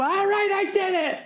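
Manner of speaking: high, hammed-up gangster-ish confession; old movie courtroom confession. Killer breaking down on the stand, hammy high old-movie voice. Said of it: "Remember those old stupid court dramas".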